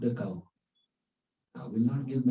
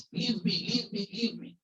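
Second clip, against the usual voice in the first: mock shouting; 11 semitones higher than usual. Guru still clinging to the tree, high mock shouting. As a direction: imitate pleading cries to be let go. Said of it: "he started shouting".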